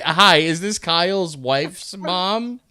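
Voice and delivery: high, slow, silly prank-call voice; prank caller voice. Shown as a demonstration. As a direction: put on a goofy caller voice. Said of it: "Just, just, eh".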